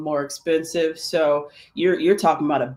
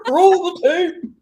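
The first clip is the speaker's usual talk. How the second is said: put-on slow, high quavery old-timer; old person voice; 14 semitones higher, 5 dB louder, slower than usual. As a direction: ham up a creaky old voice asking for the tape. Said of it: "old person voice".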